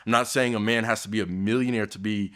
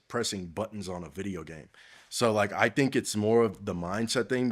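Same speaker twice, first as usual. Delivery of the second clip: usual speaking voice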